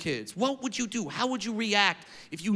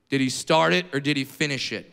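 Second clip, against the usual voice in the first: low, lightly voiced father; dad's gruff question; 8 semitones lower than usual. Dad answering the principal on phone, deadpan. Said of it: "Here's what my dad said".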